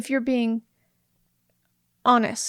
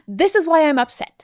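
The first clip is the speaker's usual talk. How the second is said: louder, accusing complaint; attacking tone; 3 semitones higher, 5 dB louder than usual. Shown as a demonstration. Wife demos the wrong way to bring up a complaint, on the attack. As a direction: say it like you're on the attack. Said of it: "you don't talk like that".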